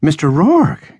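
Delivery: high-pitched scandalized exclamation; gasped in shock. A narrator's reading. Landlady stares at him from the threshold, gasping. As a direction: cry out the name, aghast. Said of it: "gasped Missus Keating".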